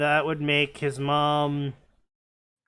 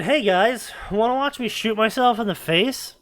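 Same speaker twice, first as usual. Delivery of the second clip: higher, louder mock enthusiasm; mock-eager announcement; 8 semitones higher, 4 dB louder than usual. Borderlands three addressing the players, mock-eager. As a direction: pitch it up like an over-keen show-off. Game voiced as eager fool.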